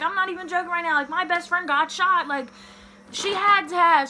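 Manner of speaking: high, frantic insistence; retold panic. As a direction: re-enact pleading that this is no joke. Retold panic over missing friend.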